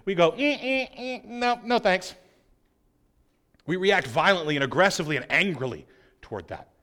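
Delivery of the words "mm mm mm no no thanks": higher, lightly mocking no thanks; dismissive refusal. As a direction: brush off the offer.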